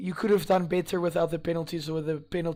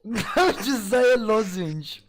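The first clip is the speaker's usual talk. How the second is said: laughing; 4 semitones higher, 4 dB louder, slower than usual. Real, not acted. Laughing while arguing with friend.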